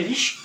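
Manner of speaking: रिश्क said with a long, pressed sh; pressure on the word. Shown as a demonstration. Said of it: "थोड़ा इसमें दबाव डालना है".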